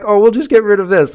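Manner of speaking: high, loud mock-official voice; mocking quote. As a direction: imitate them loudly, dripping with scorn. Mocking officials who scrub data.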